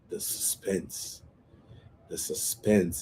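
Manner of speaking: hushed suspense. Acted. Building suspense before the judge's verdict.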